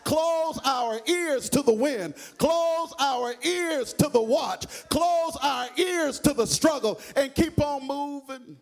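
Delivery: sung, rising preacher's whoop; whooping Baptist preacher climax; preaching at full force. Acted. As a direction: chant it out over the music, loud and high.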